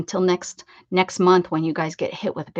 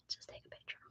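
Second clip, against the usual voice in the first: breathy whisper to self; 26 dB quieter, faster than usual. Real, not acted. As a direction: whisper it to yourself.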